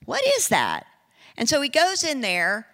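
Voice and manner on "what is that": puzzled question. Retold or imitated. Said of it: "he's like".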